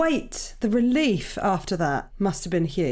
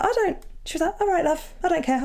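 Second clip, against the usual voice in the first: put-on much higher, light voice of a kindly old nan; voicing kind nan; 10 semitones higher than usual. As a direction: lift the pitch for an easygoing grandma. Nan voiced reacting to coming out.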